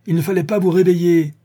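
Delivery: loud, high-pitched angry retort; exasperated outburst. A narrator's reading. Sholmes overrides his friend's excuses, exasperated outburst. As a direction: burst out at an absurd excuse.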